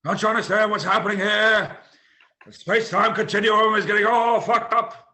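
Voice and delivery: loud, high, alarmed movie-scientist voice; frantic Doc. Acted. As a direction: cry out in hammed-up urgency. Acting out frantic Doc to Marty.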